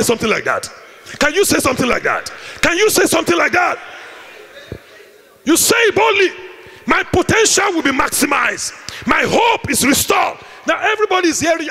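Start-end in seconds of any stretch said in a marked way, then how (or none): 5.4-11.7 boldly shouted faith confession. Shown as a demonstration. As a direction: proclaim it for all to hear.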